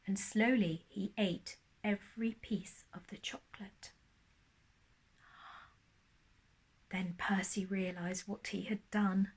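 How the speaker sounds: hushed storyteller whisper. A narrator's reading. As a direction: breathe the words, no voice. Whispered narration.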